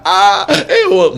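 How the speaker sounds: high squeaky alien voice with a laugh. Retold or imitated. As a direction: squeak it like an extraterrestrial. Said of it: "voz de extraterrestre".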